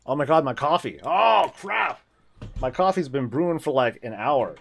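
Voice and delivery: loud startled outburst, pitch jumping up; loud, high startled cry; sudden alarm. Real, not acted. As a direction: yelp as you remember the coffee. Remembers the forgotten coffee.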